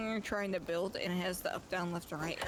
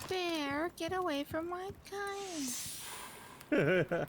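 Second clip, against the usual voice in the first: scaring off a bear; 9 semitones higher, much slower than usual. Pretend. Shooing bear in game.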